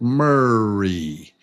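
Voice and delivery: "Murray" stretched out over two beats; drawn-out syllables. Shown as a demonstration. Teacher letting learners hear the beats, drawn-out "Murray". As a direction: hold both syllables of "Murray". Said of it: "Ah, you hear it?".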